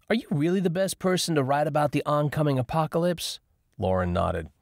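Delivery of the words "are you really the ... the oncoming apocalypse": high, teasing question; high teasing girl voice. A narrator's reading. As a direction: needle her in a high voice. Lauren teases Cadence.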